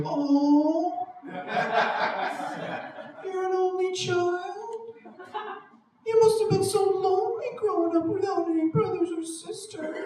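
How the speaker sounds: high, sing-song pitying tone. Retold or imitated. People pitying the only child, sing-song pity voice. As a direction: coo it like pitying a sad child. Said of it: "they talk to me in that pity voice".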